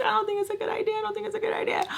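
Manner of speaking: high, soft, worried mimicry; paranoid friend. Paranoid friend doubting the piercing plan, high anxious mimicry. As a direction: imitate a nervous friend, high and hushed. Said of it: "Mel is super paranoid".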